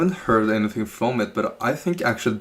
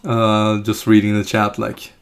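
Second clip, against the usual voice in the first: ordinary talk, nothing marked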